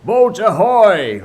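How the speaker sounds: slow, loud, high ringing hail; Hook hailing. Acted. Hook calling out to the pirates, booming hail. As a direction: call across the lagoon as the captain. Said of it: "rang over the lagoon in Hook's voice".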